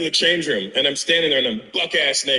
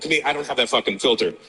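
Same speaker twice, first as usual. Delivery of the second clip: ordinary delivery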